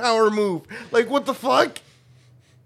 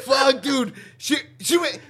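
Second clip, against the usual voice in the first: usual speaking voice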